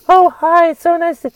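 mock the fake-sweet pitch of phonies; phony nice voice. Mocking phony people who greet with fake high-pitched niceness. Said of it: "the higher in pitch their voice goes".

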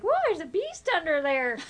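a touch of mock shock, laughing; mock surprise. Mom teasing daughter about her hairy armpit. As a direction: tease like you found a monster. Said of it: "I was like".

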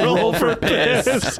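laughing slow. Real, not acted.

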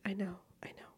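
whispered guilty aside; sheepish whisper. Real, not acted. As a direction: confess it in a whisper. Sheepish aside about the Stanley.

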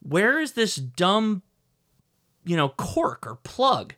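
a mild retold holler, drawn out; retold yell. Frustrated dad calling out for the missing plug, mild yell. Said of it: "I yelled out".

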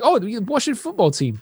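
high-pitched mock British accent; British person. Retelling a chat with British NFL fan, mock British accent. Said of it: "if I talk to a British person".

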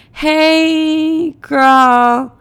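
long, high, droning hello; stoned awkward greeting. Retold or imitated. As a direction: imitate a dazed stoner stretching out the word. Repeats her stoned, awkward reply.

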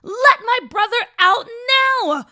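high, loud desperate scream. Acted. Sister screaming for her brother, high desperate shriek. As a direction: shriek it at the old woman. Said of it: "Gigi screamed".

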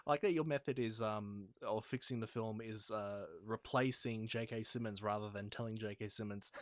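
ordinary talk

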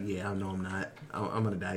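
softly resigned, hero accepting his death; resigned murmur. Retold or imitated. Retelling the hero saying goodbye, soft and resigned. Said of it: "And he's like".

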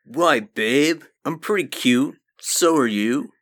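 high, loud hammed-up come-on; exaggerated mock sweet-talker reading out texts; mock creepy suitor. Reading creepy suitor's messages in character.

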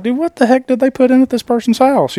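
high, disbelieving outburst; incredulous. Retold or imitated. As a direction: act baffled at the mess. Shocked at a bad install.

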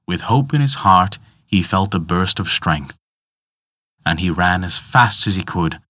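plain reading voice

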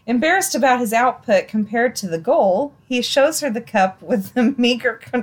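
normal talking voice

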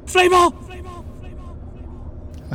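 high, carrying calls that fade off; shouting a name. Acted. Lance running, shouting for his missing companion Fleeple. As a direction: call out for the lost friend. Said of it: "shouting for Fleeple".

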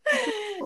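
a short high nervous laugh, holding back tears; embarrassed laugh. Real, not acted. Telling her friend about grandpa, a tearful laugh. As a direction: laugh it off before the tears come. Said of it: "ich hoffe, ich heul nicht in der Folge".

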